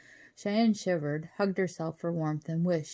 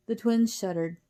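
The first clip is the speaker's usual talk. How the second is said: usual speaking voice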